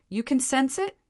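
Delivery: high-pitched startled question; startled, hurried. A narrator's reading. Charlotte to Wolfgang on his sensing Lucifer's absence, startled and hurried. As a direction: lift the pitch sharply, mildly taken aback. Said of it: "was startled and hurriedly said".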